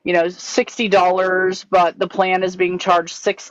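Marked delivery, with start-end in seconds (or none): none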